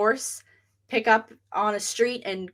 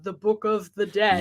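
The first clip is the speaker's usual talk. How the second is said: put-on old-timey priest voice. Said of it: "an old-timey priest voice".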